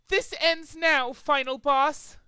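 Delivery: high, slow grave declaration; dramatic threat. A narrator's reading. Trixie confronting Final Boss, high and slow. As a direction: draw out the showdown.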